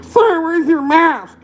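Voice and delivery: high, very slow mock whine; mocking clerk. Mimicking a petty store worker, high and drawn out. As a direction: stretch it out like a whiny clerk. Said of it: "this little peon coming up".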